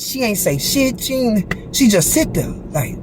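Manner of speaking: ordinary talk, nothing marked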